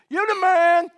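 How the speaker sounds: loud high crowd shout; yelled from the crowd. Retold or imitated. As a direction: holler it like a fan in the crowd. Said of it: "somebody from the crowd yelled".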